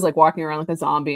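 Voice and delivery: ordinary delivery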